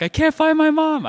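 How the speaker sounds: high-pitched acted wail of a lost kid; panicked child's cry. Lost kid wanting to tell someone, panicked cry. Kid's cry he held back.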